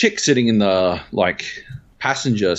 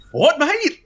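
high-pitched challenge thrown back; re-enacted shout, squaring up for a fight; confrontational yell. Acted shouted comeback in a quarrel.

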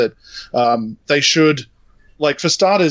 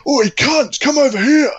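high, mocking whiny taunt; 1 mocking whiny guy; 11 semitones higher, 3 dB louder than usual. Retelling the hero guy's taunt, high mocking voice. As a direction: mimic the smug hero guy. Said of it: "this guy decides to go".

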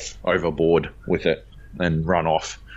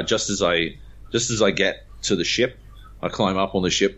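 ordinary talk, nothing marked

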